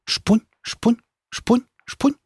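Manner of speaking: high, light bark-like voice; imitating dog bark. A narrator's reading. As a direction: copy the little dog's odd yap. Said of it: "повторила вслед за таксой".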